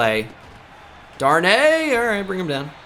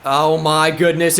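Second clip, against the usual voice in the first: ordinary talk, nothing marked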